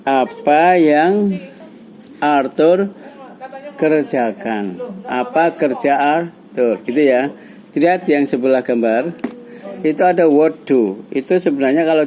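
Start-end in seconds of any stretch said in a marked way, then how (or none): 0.1-6.8 slow prompting. Shown as a demonstration. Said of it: "kira-kira apa pertanyaannya".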